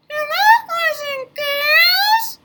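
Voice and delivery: high, slow, squeaky puppet greeting; a cheerful little puppet says hello; high cartoon puppet voice calling out; puppet Leo voice.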